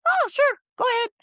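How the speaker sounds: high, loud, gushing welcome; delighted guard greeting. Retold or imitated. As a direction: greet her like a guard thrilled to see an old friend. Officials approve the school.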